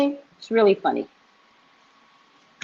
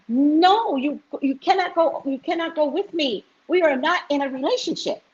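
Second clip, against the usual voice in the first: higher, pleading no; exasperated refusal; 9 semitones higher than usual. Retold or imitated. Telling the woman who follows her she cannot come, high and exasperated. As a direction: push her off, voice climbing high.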